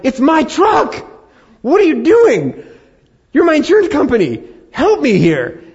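high, loud, pleading outburst; loud exasperated plea; exasperated shouting. Retold or imitated. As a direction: yell at the insurance company in disbelief. Retold outburst at insurance company.

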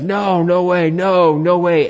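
scoffing refusal. Retold or imitated. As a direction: dismiss the idea as a scoffing crowd would. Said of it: "the people have scoffed at this".

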